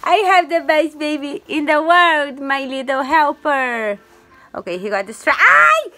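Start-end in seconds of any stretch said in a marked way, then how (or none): 5.4-5.9 high, loud ow about her hair; pained yelp. Real, not acted. Baby pulls her hair.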